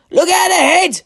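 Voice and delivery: very high, loud warning shout; urgent cry. A narrator's reading. Eric warns his crew of the rocks, loud high shout. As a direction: yell a warning over the storm. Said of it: "cried Eric".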